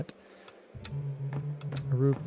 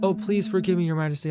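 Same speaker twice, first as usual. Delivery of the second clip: flustered apology; 4 semitones higher, 3 dB louder than usual. Acted. Rufus flustered apology read aloud.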